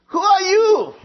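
high, loud plea to God; Moses asking. Acted. Moses calling up to God, high and loud. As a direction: cry the question upward.

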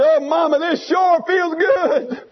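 a touch of an eaglet's delight, drawn out slowly. Retold or imitated. Baby eagle snuggling on mama's back, mildly delighted. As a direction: savor the warmth of mother's back. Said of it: "And he says".

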